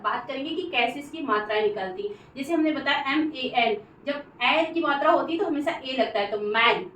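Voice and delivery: ordinary talk, nothing marked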